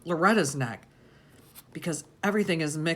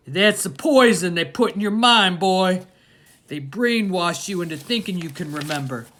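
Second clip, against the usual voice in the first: louder, menacing father's voice; angry father; 4 semitones higher, 10 dB louder than usual. A narrator's reading. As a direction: crush the boy's certainty. Father angrily dismisses boy's memory.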